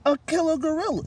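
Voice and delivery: high, loud panicked squeak; frightened squeak. Acted. Mouse telling Panda about the killer gorilla, squeaking in fear. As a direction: squeal it in terror. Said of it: "she squeaked".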